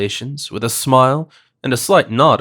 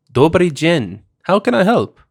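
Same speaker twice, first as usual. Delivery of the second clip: lightly voiced Slavic accent; a hint of an Eastern European accent; 6 semitones higher than usual. A narrator's reading. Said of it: "impossible for me to classify the Slavic accent".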